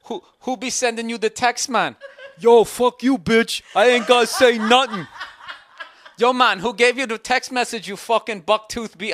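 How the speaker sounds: high, loud mock Jamaican accent; hammed-up Jamaican accent quarrel; bad Jamaican accent. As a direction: argue in a terrible Jamaican accent. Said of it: "this really bad Jamaican accent".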